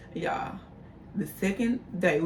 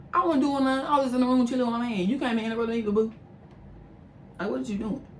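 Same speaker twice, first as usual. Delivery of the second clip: indignant protest; 5 semitones higher than usual. Real, not acted. Indignant protest at hotel manager.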